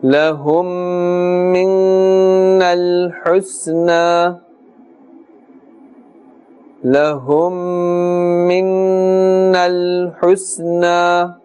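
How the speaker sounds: slow, sustained tajweed chant on a level tone; Quran recitation. Shown as a demonstration. Teacher recites the verse for learners, slow sustained chant. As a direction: chant slowly, holding the nasal hum long.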